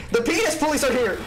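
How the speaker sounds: high, fast mock alarm; hammed-up high announcement; mock police announcement. Plays police bursting in.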